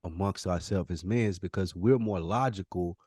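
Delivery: normal talking voice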